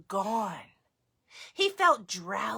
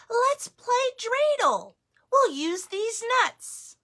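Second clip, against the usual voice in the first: high, lively grandma voice; grandmother voice; 13 semitones higher, 5 dB louder than usual. Acted. Baba Brena suggesting a game, high cheerful.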